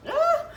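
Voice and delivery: high loud burst of frustration; a sharp exasperated yelp; sudden shriek. Real, not acted. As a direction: cry out in exasperation. Sudden exclamation.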